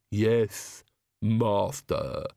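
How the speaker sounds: slightly lisped, deferential servant voice; lisping henchman answering his master; Igor, lisping servant. Acted. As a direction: lisp the word master, obediently.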